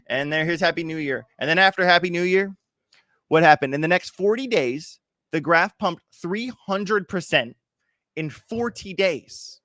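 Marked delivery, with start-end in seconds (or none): 5.3-9.6 slow, emphatic build on the numbers. Real, not acted. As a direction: lean into each number slowly. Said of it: "emphasis on the pump".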